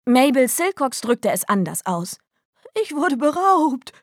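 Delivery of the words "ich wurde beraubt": high, drawn-out cry of outrage; mock scream. A narrator's reading. Mabel reporting she was robbed, high mock scream. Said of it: "schrie sie".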